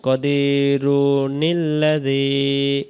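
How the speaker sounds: কাদিরুনিল্লাযী run together without a stop, slowly; joined reading. Shown as a demonstration. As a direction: link কাদির into ইল্লাযী with a নুন. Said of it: "তখন আমরা এভাবে বলতে পারবো". (না থেমে কাদিরুনিল্লাযী মিলিয়ে পড়া)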